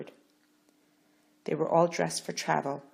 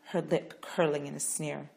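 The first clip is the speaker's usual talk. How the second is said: plain reading voice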